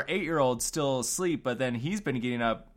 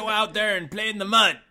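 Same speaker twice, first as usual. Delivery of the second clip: mock parent shove; 7 semitones higher, 4 dB louder, faster than usual. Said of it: "Be like".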